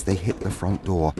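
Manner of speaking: ordinary delivery, nothing marked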